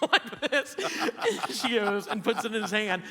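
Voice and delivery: cracking up while telling the story; slow, high words broken by laughter; laughing. Real, not acted. Laughing while retelling a prank.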